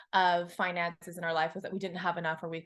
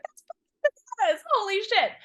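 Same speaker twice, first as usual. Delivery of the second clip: much higher and louder, giddy disbelief; excited disbelief; 13 semitones higher, 5 dB louder than usual. Retold or imitated. Her first ten thousand dollar month, thrilled disbelief. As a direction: squeal it like you can't believe your luck. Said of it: "I was like".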